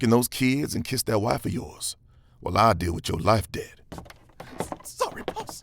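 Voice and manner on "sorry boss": whispered, high apology; sheepish whisper. Acted. Henchman whispers an apology to his boss as he leaves. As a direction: slink off with a meek whisper.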